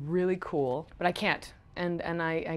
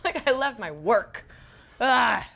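weary grown-up act; 3 semitones higher, 6 dB louder than usual. Retold or imitated. Child self mocked as weary worker.

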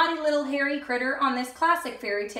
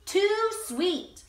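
put-on high fussy girl's complaint; picky Goldilocks; 6 semitones higher than usual. Goldilocks rejecting the sweet porridge, high and fussy. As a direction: turn your nose up at it. Goldilocks tastes second bowl.

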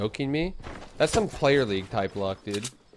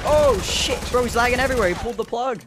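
loud high excited bro shouting; hyped gamer bro; 9 semitones higher, 7 dB louder, faster than usual. Real, not acted. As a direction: yell it out hyped at the lag. Hyped reaction to lagging player.

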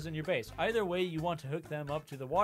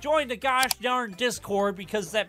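hyped outro; 5 semitones higher, 7 dB louder than usual. Real, not acted.

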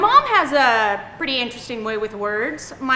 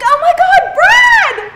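loud, high squeal of greeting; over-the-top fake reunion shout; faked delighted recognition; 12 dB louder, much slower than usual. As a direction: shriek the name like a long-lost friend. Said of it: "pretend that I know them".

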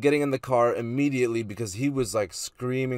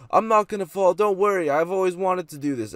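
high, louder voice for the careless friend; drunk friend reassuring; 8 semitones higher, 5 dB louder than usual. Retold or imitated. Friend up on the railing telling him not to worry, imitated high and loud. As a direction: imitate the friend calling down, pitched up. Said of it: "he's just up there like".

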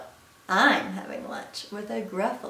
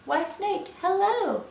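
high sing-out greeting; cheery mouse; 10 semitones higher, 4 dB louder than usual. Acted. Mouse greets snake beside the Gruffalo, cheery. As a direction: greet in a high, innocent lilt.

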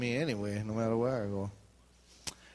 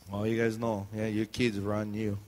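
ordinary talk, nothing marked

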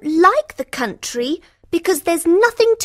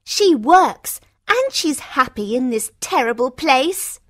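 high, indignant sister voice; resentful high-pitched grumble; angry; 5 semitones higher, 4 dB louder than usual. Acted. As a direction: complain crossly in a high pitch. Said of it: "says Rosalind angrily".

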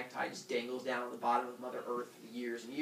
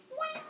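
very high "wing" sound effect; ping it out high like a cartoon blade; high-pitched zing; imitated scythe noise. Shown as a demonstration.

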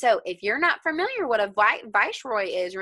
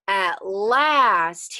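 ordinary delivery, nothing marked

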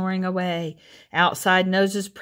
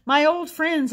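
higher, louder glad exclamation; surprised; 10 semitones higher, 4 dB louder than usual. A narrator's reading. Old Armadillo greets his old friends, surprised delight. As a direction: catch your breath in happy surprise.

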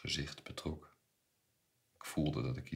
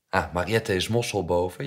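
loud, higher greeting; hearty call; 4 semitones higher, 12 dB louder than usual. A narrator's reading. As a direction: call out across the shop. Uncle greets shop girl on entering. (luide, joviale begroeting)

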